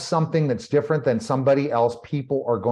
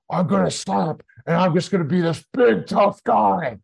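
mock tough guy; 5 semitones higher than usual. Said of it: "I'm not gonna go and say".

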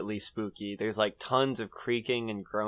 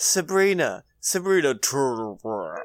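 monster blowing its cover; 3 dB louder than usual. Retold or imitated. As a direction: imitate a mimic losing its disguise. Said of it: "it keeps blowing itself, like, its cover".

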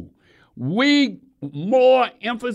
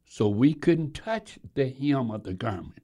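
hushed, breathy-free whisper for weight; 5 semitones lower, 6 dB quieter than usual. Real, not acted. Preacher whispers to the congregation, hushed. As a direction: drop to a whisper to draw them in.

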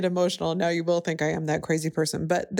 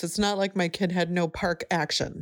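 usual speaking voice

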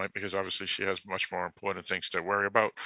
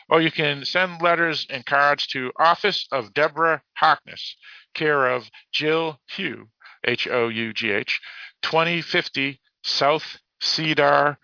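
higher, deliberate announcement read; reading a post aloud; 4 semitones higher than usual. Real, not acted. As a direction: read contact details out carefully for listeners. Reads a posted message.

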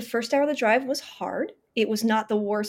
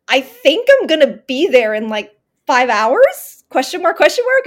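excited text; 4 semitones higher, 9 dB louder than usual. Retold or imitated. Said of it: "And I was like, Hey".

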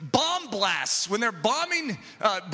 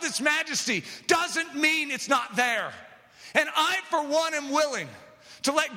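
fiery revival preacher declaring it still lives; preaching at full force; 5 semitones higher than usual. Acted. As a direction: thunder it out to the congregation.

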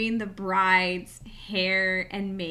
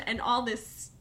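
ordinary talk

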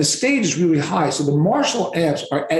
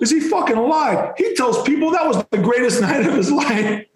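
high, excited New York wiseguy; imitated New York guy breaking into laughter; New York Sopranos voice; 9 semitones higher than usual. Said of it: "voice is one of these New York".

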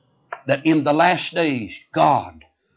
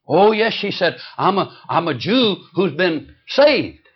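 mock eager lady; 7 semitones higher, 5 dB louder, slower than usual. Lady insists she is saved.

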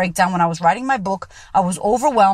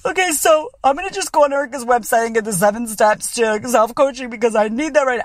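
put-on voice, unlike your own; imagine someone implausibly composed mid-meltdown; mock self-help eagerness. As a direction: parody a dutiful podcast listener. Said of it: "you're not gonna go".

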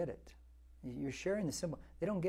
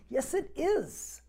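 much higher, slow insistence; emphatic retort; 10 semitones higher, much slower than usual. Real, not acted. Pastor rebutting a skeptic in the pews, slow emphatic insistence. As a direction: insist firmly against doubters.

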